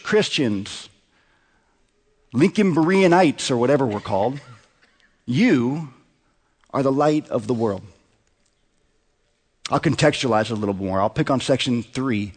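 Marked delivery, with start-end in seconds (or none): 5.3-7.8 "You" held alone, then a pause; measured declaration, "You" standing by itself before the rest; really emphatic. Real, not acted. Pastor addresses the church directly, emphatic pause after "You". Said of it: "say it really emphatically".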